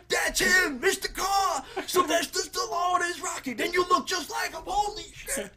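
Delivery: triumphant, chest puffed out; elated shout. Retold or imitated. Guy shouting with joy at dad's nod. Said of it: "he's like".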